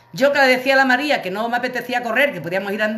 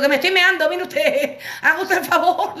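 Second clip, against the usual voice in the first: much higher, laughing, desperate; laughing plea; 9 semitones higher than usual. Retold or imitated. Telling the doorman she is wetting herself, laughing and much higher. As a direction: beg him through giggles, much higher.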